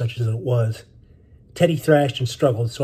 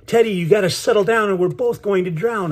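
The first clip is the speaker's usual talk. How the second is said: high, urgent yell, not at full volume; 7 semitones higher than usual. A narrator's reading. Boy to his panicking brother dragging him under, yelling. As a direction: yell at him to get a grip, pitched high. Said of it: "I yelled".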